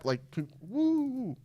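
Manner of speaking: high drawn-out cheer; excited woo. Real, not acted. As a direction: let out a happy woo. Cheering for the bonus.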